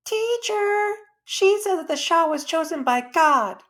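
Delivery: a schoolgirl reporting a classmate to the teacher, tattling; tattling child. Acted.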